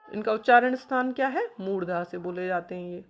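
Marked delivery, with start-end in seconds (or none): none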